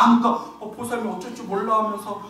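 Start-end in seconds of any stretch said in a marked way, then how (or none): none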